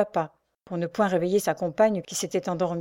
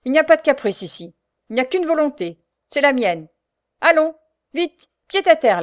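loud, raised voice of command; stern; 7 semitones higher, 7 dB louder than usual. A narrator's reading. Sergeant to balking Marianne, stern loud order. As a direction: lay down the law forcefully. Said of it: "Et sévèrement".

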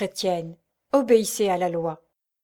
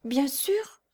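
higher small voice; child's question; 5 semitones higher, 3 dB quieter than usual. A narrator's reading. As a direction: ask hopefully as a child. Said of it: "demanda l'enfant". (petite question d'enfant)

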